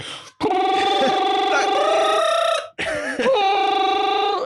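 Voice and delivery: high howling Wookiee cry; Wookiee roar. Shown as a demonstration. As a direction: wail like a Wookiee. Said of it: "Wookieed it up".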